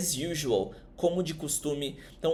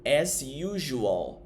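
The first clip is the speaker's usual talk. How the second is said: "As usual" drawn out slowly, each sound clear; slow careful model; much slower than usual. Shown as a demonstration. Teacher to students, drawn out slowly. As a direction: stretch "As usual" out for the class. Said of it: "Essa aqui é a técnica lar".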